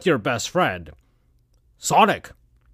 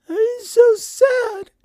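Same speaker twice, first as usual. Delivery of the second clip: very high, drawn-out falsetto wail; hammed-up tearful squeal, slow and loud; mock crying; 5 dB louder, much slower than usual. A narrator's reading. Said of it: "Amy in a crying way".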